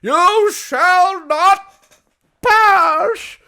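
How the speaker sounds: very slow, high, loud mock wizard boom; wizard command. Mastering your craft, booming a hammed-up wizard line. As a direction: proclaim like a wizard barring the bridge. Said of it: "Until you're like".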